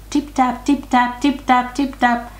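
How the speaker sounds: high, even, rhythmic chant; tiny hoofbeats. A narrator's reading. Narrator sounds tiny hoofs crossing the bridge, high patter. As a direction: tap it out lightly in a high voice.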